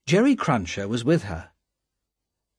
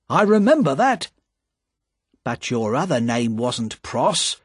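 high, loud challenging man's voice; Jerry, challenging; 9 semitones higher, 4 dB louder than usual. Acted. Jerry confronts the spy outside the wine shop, high and loud. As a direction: press him hard for his real name.

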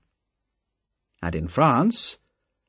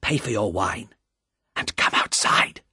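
low frightened voice; 2 semitones lower than usual. Acted. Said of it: "in a low, frightened voice".

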